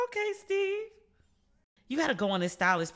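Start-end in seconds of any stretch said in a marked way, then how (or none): 0.1-0.8 high-pitched playful admiration; impressed teasing. Retold or imitated. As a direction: coo it high, admiring his look. Admiring reaction to his outfit.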